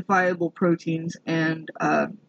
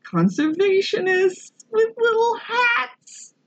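hammed-up gushing; much slower than usual. Reading the quote about dogs in little hats, hammed-up squeal. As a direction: slide from reading voice into a high squeal.

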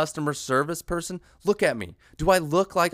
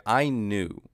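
ordinary delivery

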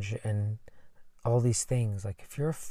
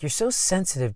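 mock taunting; 7 semitones higher than usual. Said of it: "called sensitive when I was a kid".

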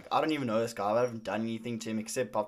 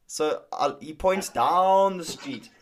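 ordinary delivery

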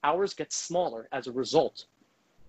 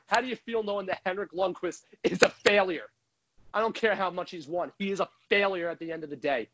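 ordinary talk

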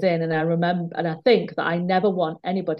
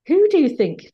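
mock-indignant challenge, higher and louder; imitating an angry director; 9 semitones higher, 6 dB louder than usual. Quoting the male director snapping at a colleague, mock anger. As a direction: hammer the question at her like an angry boss.

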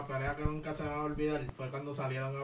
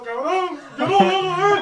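screaming high and loud, imitating Feli; hammed-up yell copying Feli in the video; mock scream of a player refusing to keep playing; 14 semitones higher, 14 dB louder than usual. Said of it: "Feli salía en el video gritando".

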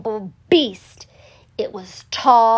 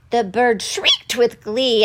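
ordinary delivery, nothing marked

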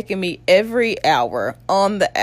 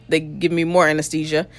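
usual speaking voice

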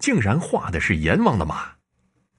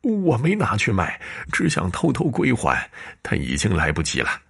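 higher, tear-choked begging for forgiveness; tearful pleading; 7 semitones higher than usual. Acted. Servant begging his master and mistress for forgiveness through tears. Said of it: "陈小二眼角渗出泪水".

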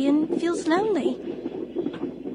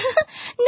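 light laugh; 8 semitones higher than usual. Acted. Flattered, laughing at compliment.

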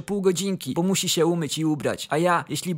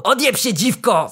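a louder rude yell; shouting back; 4 semitones higher, 6 dB louder than usual. Acted. Caroler Paweł yelling back at Kasia, rude shout. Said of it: "Paweł krzyknął".

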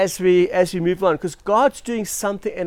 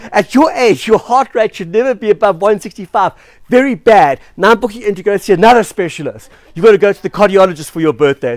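loud, raised mock telling-off; hammed-up medical reprimand; scolding doctor; 4 semitones higher, 8 dB louder than usual. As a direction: lay down the law like an alarmed doctor. Doctor scolds patient in retold story.

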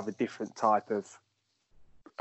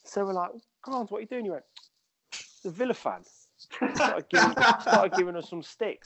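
laughing; 10 semitones higher than usual. Real, not acted. Laughing retelling the Villa fan.

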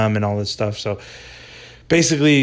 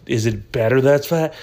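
ordinary talk

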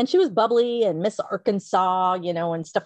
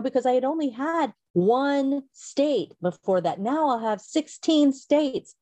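usual speaking voice

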